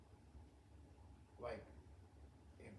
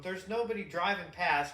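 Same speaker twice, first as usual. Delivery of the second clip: ordinary delivery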